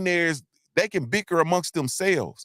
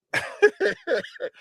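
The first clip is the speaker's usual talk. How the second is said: high laugh. Real, not acted. Laughs at own joke.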